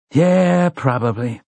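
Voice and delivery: drawn-out reluctant yeah, starting high; sighing. A narrator's reading. Man admitting trouble to the gunslinger, sighing reluctantly. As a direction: sigh out the admission, dropping at the end. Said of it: "Eddie sighed".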